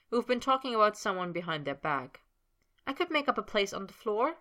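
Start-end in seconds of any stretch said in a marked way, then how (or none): 2.9-4.4 higher, falsely bright hostess voice; overbright. A narrator's reading. Sabrina fusses over Snape, falsely overbright. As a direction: cover the gossip with cheer. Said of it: "overbright manner".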